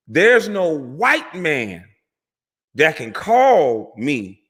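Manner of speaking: slow, weighty delivery with pauses; deliberate quote. Retold or imitated. Commentator quoting how the doctor ended it, slow and deliberate. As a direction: spell it out slowly, phrase by phrase.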